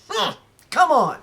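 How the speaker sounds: high, put-upon come on; exasperated groan. Real, not acted. Collector complaining to viewers, mock exasperated. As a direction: sigh it out in mock frustration. Frustrated at same features.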